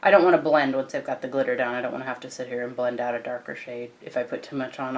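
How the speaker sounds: ordinary talk, nothing marked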